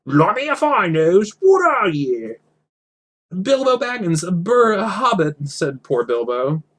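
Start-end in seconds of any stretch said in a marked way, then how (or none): 0.1-2.4 much higher, louder troll crowing over his catch; a slow, high troll exclaiming; excited troll. Acted. 3.4-5.5 frightened. Acted. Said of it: "shaking all over".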